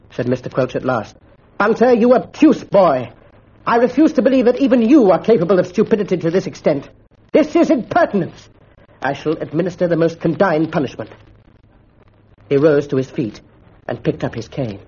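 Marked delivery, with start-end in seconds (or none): 1.6-11.1 stern master's rebuke. A narrator's reading. Mister Quelch berates Bunter with stern anger. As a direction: scold the boy from above. Schoolmaster scolding.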